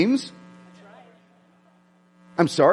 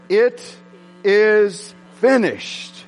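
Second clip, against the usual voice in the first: slow solemn quote; 3 semitones higher, much slower than usual. Acted. Preacher quoting Jesus' last words to congregation, slow and solemn. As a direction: lay down the last words slowly.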